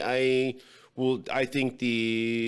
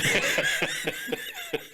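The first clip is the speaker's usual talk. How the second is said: high squeaky giggle. Real, not acted. Giggle after joke.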